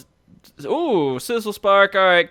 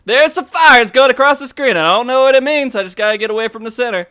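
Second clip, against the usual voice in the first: high, loud mock panic; 6 semitones higher, 5 dB louder than usual. Streamer mocking his teammate's panic at the fire, loud mock panic. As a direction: act out a panicked newbie. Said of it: "You're like".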